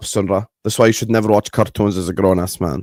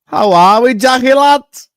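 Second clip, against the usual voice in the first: loud greeting shout; 14 semitones higher, 10 dB louder, slower than usual. Real, not acted.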